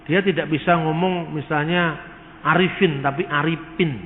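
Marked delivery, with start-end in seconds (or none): 3.2-4.0 Sundanese speaker saying Aripin with P not F; "Aripin" said with a P sound where F belongs; Sundanese accent. Shown as a demonstration. Lecturer imitates a Sundanese speaker, mild Sundanese accent. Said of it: "Orang Sunda sulit untuk mengucapkan huruf F".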